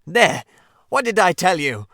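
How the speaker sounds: loud, high triumphant cry. Acted. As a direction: crow over the find. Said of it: "exclaimed the Rat in great triumph".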